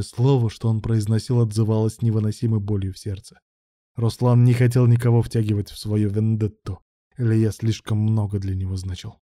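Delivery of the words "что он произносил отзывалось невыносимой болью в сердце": plain reading voice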